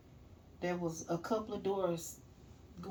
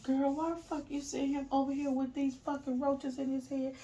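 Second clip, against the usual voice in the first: mock cussing out; 8 semitones higher than usual. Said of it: "She was cussing Dolly out".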